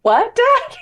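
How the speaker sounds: high-pitched mock disbelief; incredulous at Dad's joke; baffled reaction. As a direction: squeak it in laughing bafflement.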